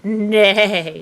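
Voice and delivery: mock neigh; badly faked horse whinny; horse impression. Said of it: "you do a way better horse than me".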